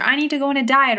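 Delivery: hammed-up anxious inner voice; mock panic. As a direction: rush it like a frantic self-talk. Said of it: "from this space of panic and desperation and fear".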